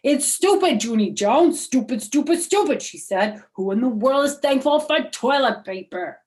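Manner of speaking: high, loud, indignant shouting of stupid; angry girl. Acted. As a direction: stamp your foot and yell stupid. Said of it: "May stamped her foot".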